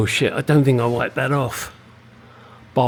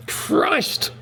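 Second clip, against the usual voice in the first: a higher, breathy cry of exasperation; exasperated outburst; 13 semitones higher than usual. Real, not acted. Fed up with the leaking engine, higher exasperated cry. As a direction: throw the word up in despair. Coolant leak frustration peaks.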